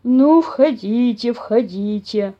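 very slow, lumbering bear voice; welcoming bear. A narrator's reading. Papa bear inviting the mice in, welcoming. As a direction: draw out the invitation, pleased. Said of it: "обрадовался папаша Бамсе".

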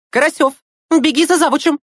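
high, loud cry breaking with indignation; indignant cry. A narrator's reading. Teacher orders Karasyov to fetch the head, crying out indignantly. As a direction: shout out the order, choking on outrage. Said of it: "вскричала Кира Давидовна".

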